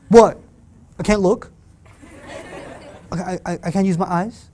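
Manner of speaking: indignant, sharp retort; defensive teen. Pretend. Teen sassing mom about the look. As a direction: snap back defensively. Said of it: "your mother said".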